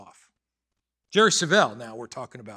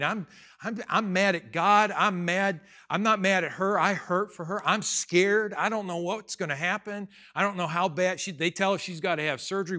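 high, loud, agitated venting; worked-up, raised-voice ranting; distraught anger; 4 semitones higher, 4 dB louder than usual. Retold or imitated. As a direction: pour out hurt and fear in a rush of complaint. Reliving his anger at God.

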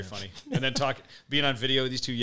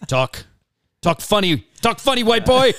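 high, loud bad Mexican accent; hammy Mexican accent taunt; 10 semitones higher, 11 dB louder, slower than usual. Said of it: "The worst Mexican accent ever".

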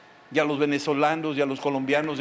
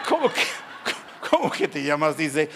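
shocked disbelief. Retold or imitated. Man shocked at the name.

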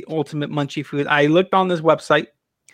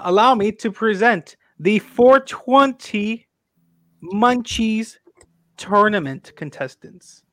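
announcer voice; 5 semitones higher, slower than usual. Acted. Formally presenting tournament contestants.

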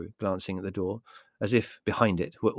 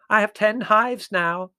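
slow, loud, high boast; booming old aunt showing off; proud; 13 semitones higher, 8 dB louder, slower than usual. A narrator's reading. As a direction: announce it proudly, as if deaf. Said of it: "said Aunt Fan proudly".

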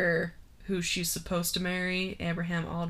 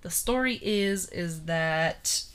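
ordinary talk

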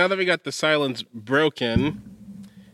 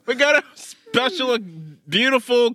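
hyped-up intro announcer; 7 semitones higher than usual. Acted. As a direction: belt it like a show host.

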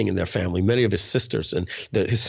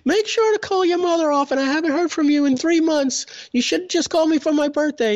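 high, loud mock-mom voice; a hammed-up nagging mother on the phone; squeaky put-on mom voice, much higher; imitating mom; 3 dB louder than usual. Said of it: "And she'd be like".

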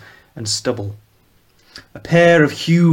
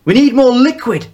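loud, much higher urgent call; urgent shout; 12 semitones higher, 7 dB louder than usual. Acted. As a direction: demand it fast. Urgent order over twitching body.

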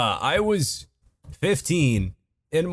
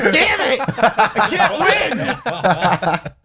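mock frustrated yell; 6 semitones higher, much slower than usual. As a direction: wail it out like a sore loser. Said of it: "I was like".

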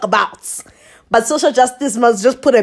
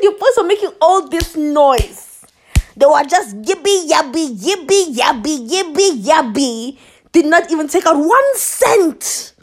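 raised, emphatic complaint with singsong mockery; retold scolding; 5 semitones higher, 4 dB louder than usual. Woman scolding him about his boys, raised indignation. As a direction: tell him off in a high, mocking rant.

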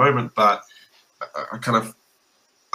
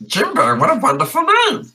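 much higher, playfully gushing; mock delight; 14 semitones higher, 4 dB louder than usual. Player fawns over the lizard's name in a much higher voice. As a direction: gush over a silly name.

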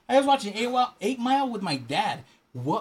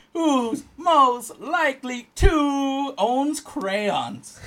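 slow, loud, raised read-out; drawn-out card reading; 6 semitones higher, 5 dB louder, much slower than usual. Real, not acted. Reading the card to friend, drawn out. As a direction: stretch each word like a game announcer.

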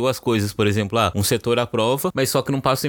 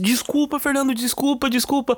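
much higher, pleading sorry; desperate apology; 13 semitones higher than usual. Retold or imitated. School owner begging Fernando's pardon, high desperate pleading. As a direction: beg forgiveness in a high frantic voice. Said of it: "desesperado, falou assim".

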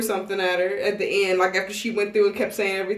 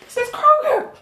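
much higher, laughing excitement; laughing delight; 6 dB louder than usual. Retold or imitated. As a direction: squeal it like you found home. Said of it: "I was like".

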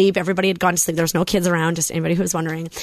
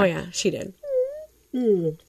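quiet and tearful; 5 dB quieter than usual. Real, not acted. Tearful about late grandma.

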